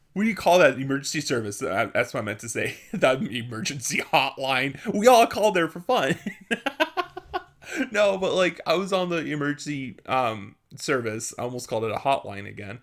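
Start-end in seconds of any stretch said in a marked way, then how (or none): 6.2-7.4 squealing laugh; laughing at her own joke; high giggle. Real, not acted. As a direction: let a high giggle slip out.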